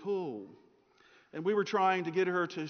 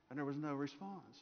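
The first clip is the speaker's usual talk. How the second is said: normal talking voice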